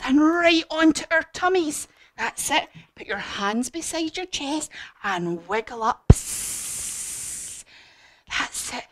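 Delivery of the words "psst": long voiceless hiss; soft, sustained sibilant; snake hiss. Shown as a demonstration. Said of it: "Hissing like a snake".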